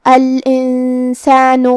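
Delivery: slow, drawn-out tajweed chant; Quran recitation. Shown as a demonstration. Teacher demonstrating the rule in Quranic chant. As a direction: recite with long held vowels. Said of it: "حکم کو ادا کریں گے".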